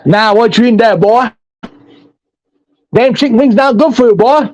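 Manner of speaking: hammed-up backwoods moonshiner sizing up strangers; Kentucky hillbilly. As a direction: play the creepy mountain man for laughs. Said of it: "if we're gonna go hillbilly all the way".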